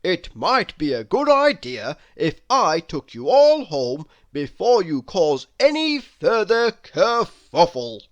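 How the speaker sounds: loud, emphatic bird voice; blackbird character. Acted. Blackbird offering the mice a lift home, loudly emphatic. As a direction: project it firmly and theatrically. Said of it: "continued Charles".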